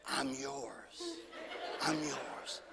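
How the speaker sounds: low, soft tempting ball voice. Retold or imitated. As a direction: purr it like a toy calling you. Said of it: "it said".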